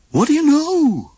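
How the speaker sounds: high, cheerful goofy voice; Goofy grinning at the snow. A narrator's reading. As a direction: say it with a big dopey grin. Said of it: "grinned Goofy".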